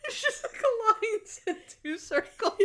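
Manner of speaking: breathless high giggle through the words; laughing. Real, not acted. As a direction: squeal it out while laughing. Laughing at the sketch.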